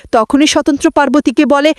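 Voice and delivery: ordinary delivery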